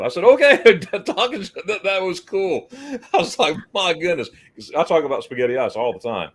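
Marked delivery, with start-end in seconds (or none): 0.2-4.3 thrilled. Real, not acted. Owner subscribes to his channel.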